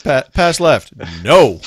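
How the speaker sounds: booming playful "no"; mock refusal. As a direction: act out a stubborn refusal. Acts out driver's refusal.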